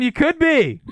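high, loud teasing call; excited. Real, not acted. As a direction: sing it out to tease him. Teasing singsong hype.

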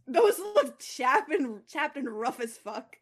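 cackling at the idea; laughing. Real, not acted. Friend mocking Thor's lips while laughing.

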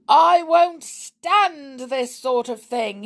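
high, loud, drawn-out fury; raging. A narrator's reading. Bitten man complaining to the household, raging. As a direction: rage indignantly, leaning on the words. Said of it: "he raged".

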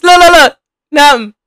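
excited shout at the dancers on screen; excited calling out. Real, not acted. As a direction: call it out at the screen.

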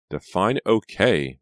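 arch, drawn-out retort; wry reply. A narrator's reading. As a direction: stretch it out drily. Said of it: "Reva replied".

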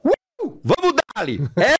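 high, loud, pumped-up yell to fire himself up; hyped self-pep shout. Shown as a demonstration. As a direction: shout it like a pre-fight hype-up. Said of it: "e faço".

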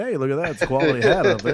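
a hint of a baseball announcer admiring the hat; lightly voiced TV announcer, mock praise. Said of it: "the announcer's going".

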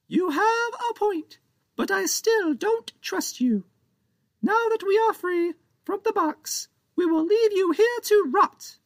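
a pint-sized pirate captain venting his grievance; tiny pirate captain blaming Captain Joe, squeaky and cross; angry tiny pirate. Acted. Captain Big voiced.

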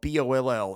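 letters B O L L said one by one; spelling it out. Shown as a demonstration. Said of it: "That is spelled".

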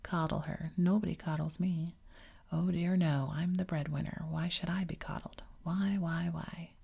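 usual speaking voice

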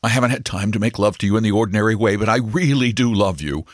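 a busy man blurting out a marriage proposal in a hurry; hurried, flustered broker. Acted. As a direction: broker rushing his proposal to the stenographer, fast and high. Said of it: "he began hurriedly".

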